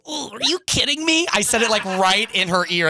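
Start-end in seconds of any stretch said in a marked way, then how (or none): 0.1-1.3 exasperated whine. Retold or imitated. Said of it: "you go".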